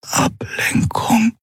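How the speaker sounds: parched, croaking whisper; thirsty. Acted. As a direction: rasp it out, slow and dry. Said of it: "krächzte er".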